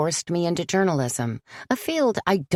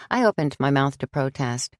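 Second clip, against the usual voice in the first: ordinary delivery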